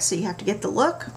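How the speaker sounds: ordinary talk, nothing marked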